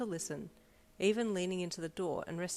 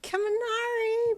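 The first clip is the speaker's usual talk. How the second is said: high slow drawn-out wail; 10 dB louder, slower than usual. A narrator's reading. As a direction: plead to his friend. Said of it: "Mineta wailed".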